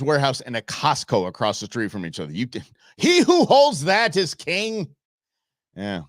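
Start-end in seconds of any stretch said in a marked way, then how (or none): 3.0-4.9 high, slow, ringing decree; mock-grand proclamation. Host on holding the warehouse and Costco, mock-grand proclamation. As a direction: proclaim it like a mock royal edict.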